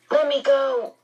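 high, sharp command. A narrator's reading. Charles orders Meg to release his arm, sharp. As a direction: order her off sharply, pitch jumping up. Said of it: "Charles said sharply".

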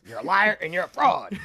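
loud ranting accusation with laughter; mock Alex Jones. Friend imitating a pundit's tirade, laughing mock rant. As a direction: rant it, cracking up. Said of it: "where he's like".

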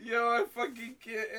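ordinary delivery

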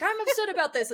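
high mock whine; exaggerated high upset voice, mocking herself; mock upset post. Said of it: "I'll be like".

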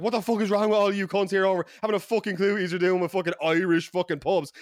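loud, high, ranting insults; losing his mind. Retold or imitated. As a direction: re-enact an Irish tirade at the whole room. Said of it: "losing my mind".

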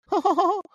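very high, loud falsetto chuckle; squeaky cartoon laugh; Mickey Mouse laugh. Officer capping his mockery of state officials, Mickey Mouse chuckle. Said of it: "My worst Mickey Mouse voice".

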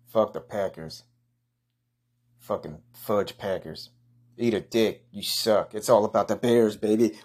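normal talking voice

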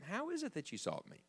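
put-on very quiet answer from the boy; soft, calm reply. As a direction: answer the scolding softly and innocently. Voicing young Jesus' answer to mother.

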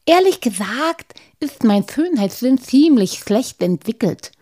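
a shy gnome owning up, voiced high; Halbschuh the gnome.

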